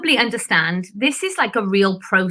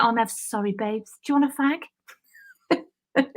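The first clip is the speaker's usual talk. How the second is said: soft sweet cop; 3 semitones higher, 5 dB quieter than usual. Retold or imitated. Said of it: "So I'd be like".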